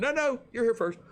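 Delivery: courteously waving him ahead; polite insisting. Retold or imitated. Couple at the ramp politely insisting he go first. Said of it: "They said".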